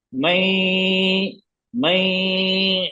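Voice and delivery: "मैं" stretched very slowly through the nose; long nasal hold. Shown as a demonstration. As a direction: hum out the end of "मैं" nasally. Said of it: "लंबा खींचेंगे इसको".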